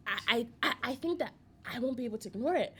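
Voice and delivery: ordinary delivery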